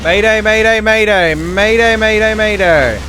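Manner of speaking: loud, high urgent distress call; mayday radio call. Pretend. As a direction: shout it like a crashing pilot. Ship going down in game.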